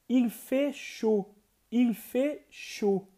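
"Il fait chaud" said slowly and clearly; slow French model. Shown as a demonstration. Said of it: "Está muito quente". ("Il fait chaud" bem devagar)

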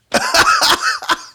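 very high-pitched burst of laughter; high squealing laugh. Real, not acted. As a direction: shriek with laughter. Laughing at the story.

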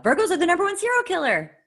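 high and loud with glee; excited. Real, not acted. Telling Ryan about Virgo killers, gleefully excited. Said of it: "You said that so excitedly".

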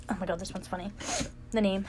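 stuffy, congested voice; blocked nose. Real, not acted. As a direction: talk through a blocked nose. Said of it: "I can't breathe out my nose".

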